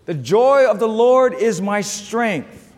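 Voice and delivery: slow, loud and high, every word weighted; slow preaching declaration. Acted. Preacher declaring to the church, slow and loud. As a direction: declare it slowly from the pulpit. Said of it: "preaching climax".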